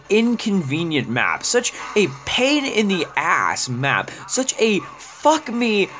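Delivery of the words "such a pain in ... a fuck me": ordinary delivery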